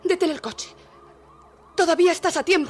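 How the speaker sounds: loud, high pleading cry; desperate shout. Acted. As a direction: shout at him to stop. Nun pleads as police close in.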